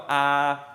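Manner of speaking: open, held "Ah"; dentist's "Ah". Shown as a demonstration. Teacher showing the Spanish A, "Ah" like at the dentist. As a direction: open the mouth wide on "Ah". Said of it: "with a big mouth".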